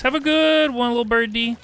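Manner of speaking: sing-song farewell. Real, not acted.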